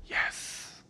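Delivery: soft whispered answer; Jesus answering the father, hushed whisper; whispered yes. Acted. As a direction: breathe the yes gently.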